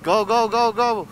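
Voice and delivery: high, loud go-go-go; excited urging. Real, not acted. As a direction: cheer yourself on. Urging the ride through roadwork.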